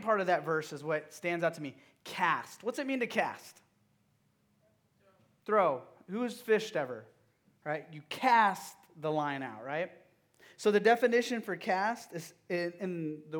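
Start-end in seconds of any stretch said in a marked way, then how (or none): none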